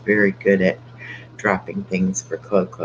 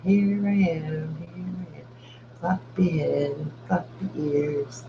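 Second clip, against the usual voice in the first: higher, slow cutesy dog voice, trailing softly; voicing the dog; 6 semitones higher, 6 dB quieter than usual. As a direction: slowly give the dog a sweet little voice. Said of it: "She said".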